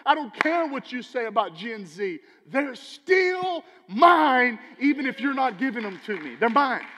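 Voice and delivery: rising to a shout on still mine; booming, possessive God voice; God's fierce claim. Acted. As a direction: preach God's claim, building loud. God's voice quoted in sermon.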